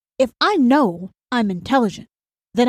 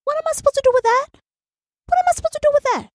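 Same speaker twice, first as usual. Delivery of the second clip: high, fast, squeaky exasperated cry; flustered disbelief; much faster than usual. Real, not acted. The host reacts to the Mister Sausage message in high, fast disbelief. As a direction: sputter it out, too stunned to cope. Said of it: "I can't even get the words out".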